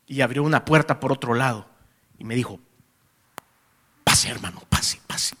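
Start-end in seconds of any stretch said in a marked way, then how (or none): 4.1-5.4 low, soft, nearly whispered welcome; hushed invitation. Retold or imitated. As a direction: usher him in discreetly, voice down. Host lets him in secretly. (invitación en voz baja, casi susurrada)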